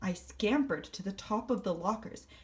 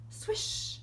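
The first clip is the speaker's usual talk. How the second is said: high breathy swish of a tail; 꼬리가 휙 지나가는 소리를 흉내 내며; whooshing swish. Acted. Tail swish sound effect.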